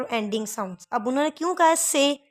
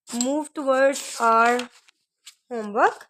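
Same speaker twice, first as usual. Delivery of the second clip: very slow; much slower than usual. Shown as a demonstration. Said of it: "very slow English phrase".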